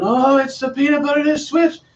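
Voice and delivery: a mock yell from an assembly kid; mock kids shouting. Magician imitating kids calling out the switch, in a high mock yell. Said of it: "they start screaming".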